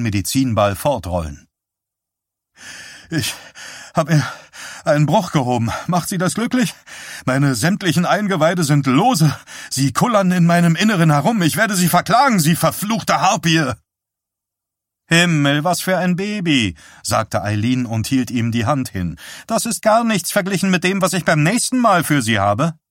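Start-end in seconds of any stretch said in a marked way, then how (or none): 3.1-13.7 higher-pitched, breathless indignant rant; exhausted, aggrieved griping; strained complaint. A narrator's reading. Exhausted patient rails at trainer. 15.1-16.7 high, mocking exclamation; exasperated, mock-appalled jeer; scoffing. A narrator's reading. Trainer scoffs at patient's complaint. 19.5-22.8 high-pitched, gleeful warning; shrill, playful menace; teasing threat. A narrator's reading. Trainer teases with a threat.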